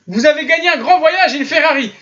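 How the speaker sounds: high-pitched mock contest announcement; hammed-up scam pitch; mock prize announcer.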